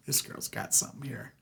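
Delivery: unvoiced conspiratorial whisper. Opposition men whispering about Katie, unvoiced. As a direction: whisper it behind her back.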